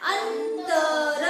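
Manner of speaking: "अंतर" stretched very slowly, sung out; drawn-out reading. Shown as a demonstration. Teacher drawls "अंतर" for the pupils. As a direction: sing out "अंतर" slowly so the n is heard. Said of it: "हा शब्द कसा वाचायचा?".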